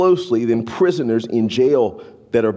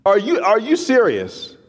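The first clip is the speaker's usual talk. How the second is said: incredulous; 9 semitones higher than usual. Retold or imitated. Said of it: "I'm like".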